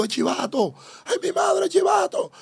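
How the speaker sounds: high, mocking street shout; jeering neighbors. Mimicking neighbors taunting the freed snitch, high mock yell. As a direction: screech it like the whole barrio jeering.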